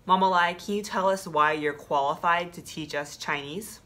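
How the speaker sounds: usual speaking voice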